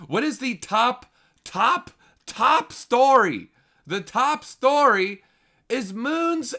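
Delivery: high, loud and drawn out like a hype man; milk every word, slow and booming; hyped-up build. Acted. Streamer hypes the top story for viewers, loud and drawn out. Hyping the top Reddit post.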